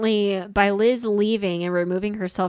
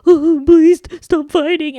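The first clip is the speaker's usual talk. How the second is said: higher, louder, slow pleading whine; mock whiny Big Ed; 7 semitones higher, 10 dB louder than usual. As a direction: beg them to stop like a helpless tubby baby. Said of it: "just sitting there being like".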